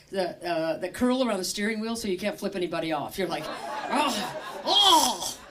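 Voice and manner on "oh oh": mock road rage. Driver thwarted by special gloves, in mock fury.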